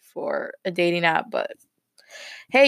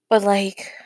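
whispering. Real, not acted. Aside about the silent guy.

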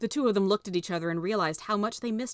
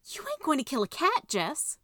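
yelped; 8 semitones higher than usual. Acted. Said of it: "she yelped".

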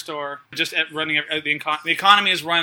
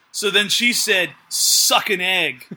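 slow tough-guy delivery; much slower than usual. Retold or imitated. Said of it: "what he says is".

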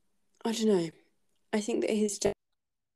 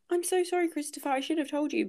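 high, whiny mock sorry, rattled off fast; mocking apology; 7 semitones higher, faster than usual. Student mocking the dad's apology to Christopher, in a high sarcastic whine. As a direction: whine it out like an apology you don't mean. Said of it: "and then he's like".

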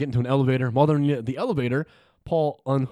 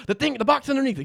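urgent high order; 12 semitones higher than usual. Acted. Hero urgently orders cop.

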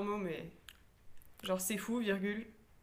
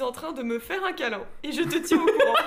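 after laughing hard; 6 semitones higher, 10 dB louder than usual. Real, not acted. Reading funny autocorrect texts.

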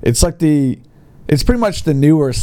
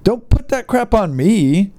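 mock grumpy seller; 8 semitones higher than usual. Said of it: "It's like".

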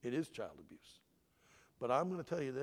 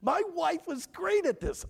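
usual speaking voice

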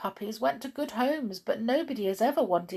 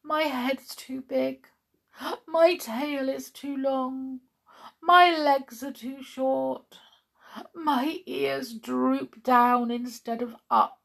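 high-pitched, doleful dog listing woes; sad dog; 7 semitones higher, 6 dB louder than usual. A narrator's reading. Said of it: "said the dog sadly".

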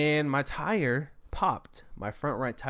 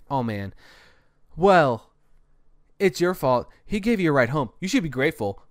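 retold yelling; 3 semitones higher, 7 dB louder than usual. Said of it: "they start yelling at me".

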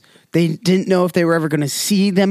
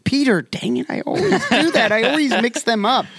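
flustered correction; 8 semitones higher than usual. Acted. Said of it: "be speechless and be like".

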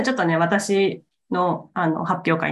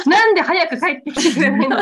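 giggling through a higher mock whine; laughing; 9 semitones higher than usual. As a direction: repeat the nag while cracking up. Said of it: "っていうの".

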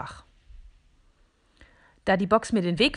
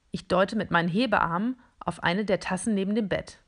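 ordinary delivery

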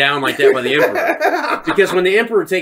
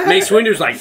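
normal talking voice

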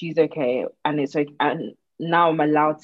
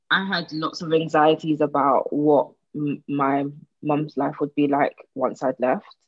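ordinary talk, nothing marked

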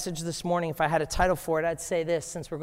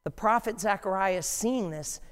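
ordinary talk

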